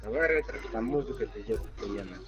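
ordinary talk, nothing marked

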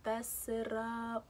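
slow, stretched fess it up; drawn out. Shown as a demonstration. Repeating her own phrase to the viewers, slow and drawn. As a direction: savour the phrase slowly. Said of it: "I was about to fess it up".

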